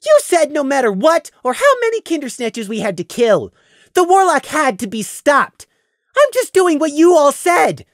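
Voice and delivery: high, loud accusing voice; Prey's voice, accusing. Acted. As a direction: throw their words back at them.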